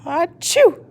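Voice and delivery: small light sneeze; a gentle sneeze; soft sneeze. A narrator's reading. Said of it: "Skeleton sneezed softly".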